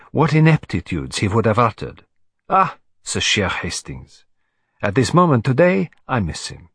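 put-on accented detective musing on a friend; fond exclamations that sink away at the end; Hercule Poirot, wistful. Thought Poirot.

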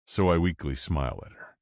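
ordinary delivery, nothing marked

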